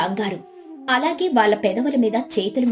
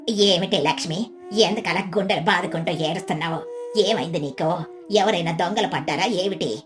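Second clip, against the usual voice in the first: lower-pitched nosy neighbour; low, uneasy village aunt; older neighbour feigning concern; 4 semitones lower than usual. Acted. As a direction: ask with put-on alarm. Voices Gangamma.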